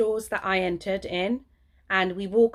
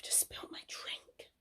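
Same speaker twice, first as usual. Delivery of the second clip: unvoiced slow whisper; whispered aside; 18 dB quieter, slower than usual. Real, not acted. Vlogger telling viewers she spilt her drink, whispered. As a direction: breathe it out as a confession. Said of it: "aside after returning".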